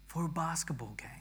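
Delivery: unvoiced whispered echo. Shown as a demonstration. Echoing his own line to the church as a whisper. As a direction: drop it to a breathy whisper. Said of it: "repeats it whispered".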